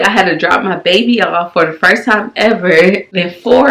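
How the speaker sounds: ordinary delivery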